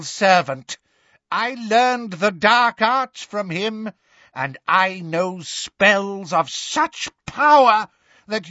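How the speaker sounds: slow, high, grandiose declaration; haughty witch building to contempt; boastful villainess. A narrator's reading. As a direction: proclaim your power, rising to high scorn. Bellatrix boasts and scorns Harry.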